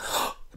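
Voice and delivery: sharp sudden intake of breath; shocked gasp. Retold or imitated. Watching him bite his friend's neck, a shocked gasp. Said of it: "and I was like".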